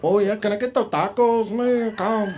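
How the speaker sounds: hammed-up Mexican accent at the counter. Diner ordering tacos in a put-on Mexican accent. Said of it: "use a fucking Mexican accent".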